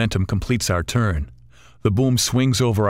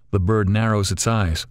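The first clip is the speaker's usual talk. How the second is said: usual speaking voice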